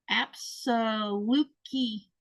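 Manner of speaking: "Apsaluki" sounded out slowly, syllable by syllable; halting, drawn-out attempt at "Apsaluki"; unsure pronunciation. Real, not acted. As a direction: feel your way through "Apsaluki". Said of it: "I bet I said that wrong".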